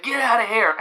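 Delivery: high put-on voice; imitated character. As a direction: shoo someone off in a high character voice. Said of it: "and then he was like".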